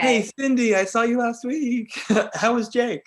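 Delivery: high, loud, gushing hello; hammed-up greeting to an old acquaintance; put-on high-pitched chummy voice; mock friendly greeting.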